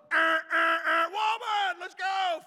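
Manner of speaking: high, loud, hurried dad shouting; impatient father calling from the van; impatient dad yelling. Retold or imitated. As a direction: bellow like a father late for church. Neighbors hear dad yelling again.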